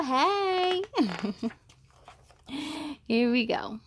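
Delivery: usual speaking voice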